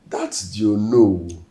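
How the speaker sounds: loud, drawn-out emphasis on each phrase; heated insistence. Acted. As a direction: raise your voice and stretch the words out, slow and forceful. Heated argument with sibling.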